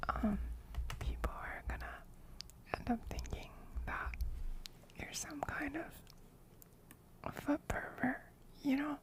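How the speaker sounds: soft ASMR whisper close to the mic; ear whispering. Real, not acted. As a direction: breathe it right into their ear. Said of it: "somebody else redeemed whispering".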